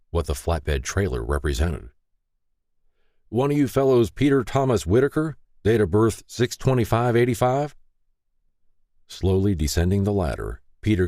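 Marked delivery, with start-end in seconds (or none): 3.3-7.7 higher, brisk official voice of a tow truck driver; official tow operator. Acted. Tow truck operator checks who Peter is, brisk and official. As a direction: read out the name like a clipboard check.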